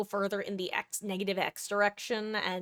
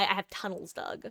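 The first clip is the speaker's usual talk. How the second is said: usual speaking voice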